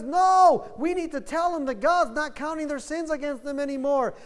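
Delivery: high, loud pleading call, slowing on come back home; impassioned plea. Retold or imitated. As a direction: urge sinners to come home. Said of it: "He says".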